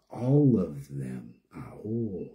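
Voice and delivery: low quiet final echo; hushed. Real, not acted. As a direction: let it sink into a low quiet close. Solemn closing echo.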